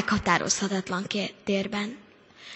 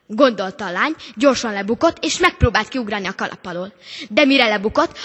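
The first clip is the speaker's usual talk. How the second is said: normal talking voice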